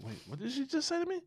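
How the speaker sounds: high, incredulous double-take; startled disbelief. Acted. Man made to think twice, startled. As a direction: act jolted into attention. Acts man shocked by remark.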